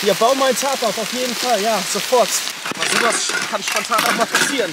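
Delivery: loud, urgent shout; shouting over rain. Real, not acted. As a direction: call out over the downpour. (laut gegen den Regen rufen)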